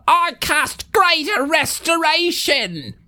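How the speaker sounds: high, slow drawn-out incantation; spellcasting little guard. Acted. Little guard to his fallen friend, high-pitched spellcasting. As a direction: declaim the spell high, stretching the words.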